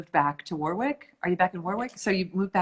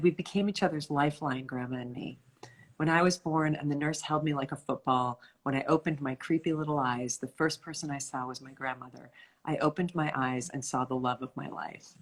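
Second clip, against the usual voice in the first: low, soft reading aloud; tender book reading; 4 semitones lower, 5 dB quieter than usual. A narrator's reading. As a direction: read the memoir passage softly and low, letting it fade.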